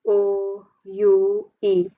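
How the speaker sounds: "O U E" slowly, each letter apart. Shown as a demonstration. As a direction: spell out "O U E" with gaps. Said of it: "You have".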